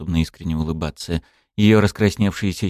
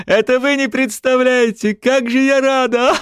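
triumphant gloating; 3 dB louder than usual. Acted.

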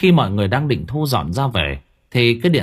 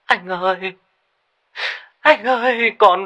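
wailing; 10 semitones higher than usual. Acted. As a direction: cry out in despair. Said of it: "chợt gào lên". (gào khóc thảm thiết)

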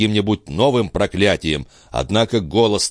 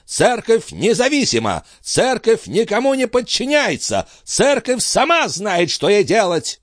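high, strained proclamation; impassioned high-pitched defence; defiant declaration; 12 semitones higher than usual. Acted. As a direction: declaim it indignantly, slowing for weight. Abbot defiantly defending the Church.

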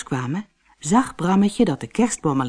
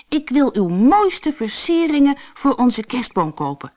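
put-on higher child's voice, politely asking; a little one asking the shopkeeper, lightly higher; Brammetje's voice; 7 semitones higher than usual. Small Brammetje asks Wouter for ornaments, higher polite voice.

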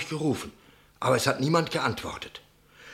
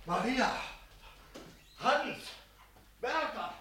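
calling out from afar; 10 semitones higher, 10 dB quieter, slower than usual. Acted. Innkeeper calls the household.

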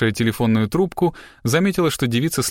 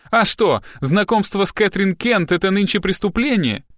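high-pitched indignant question; defiant retort; 8 semitones higher than usual. A narrator's reading. Captive girl challenges the detective's questioning, indignant. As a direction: throw it back, pitched right up.

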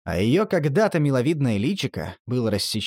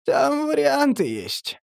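high, nasal, giggling junkie; nasal giggly voice; 11 semitones higher, slower than usual. Acted. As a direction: snicker it through the nose. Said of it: "смешливо прогнусавил Торчок".

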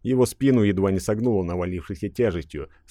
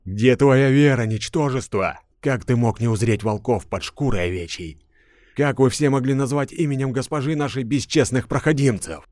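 louder, raging priestess; furious rebuke; 5 semitones higher, 3 dB louder than usual. A narrator's reading. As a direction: lash out in holy rage. Priestess in fury.

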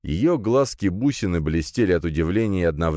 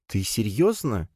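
slow, higher questioning tone; distrustful; 4 semitones higher, slower than usual. A narrator's reading. Princess to hero offering the scroll, distrustful. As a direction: ask it slowly, not quite believing. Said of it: "недоверчиво покосилась на меня принцесса".

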